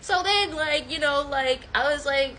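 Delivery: ordinary delivery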